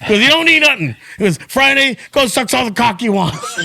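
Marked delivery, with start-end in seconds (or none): 0.2-0.9 much higher, louder hyped rant; Joey's pumped-up voice. Retold or imitated. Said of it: "And he would say like that". 1.5-3.6 much higher, hyped and laughing through it; Joey's pumped-up voice, laughing. Retold or imitated. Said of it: "And he would say like that".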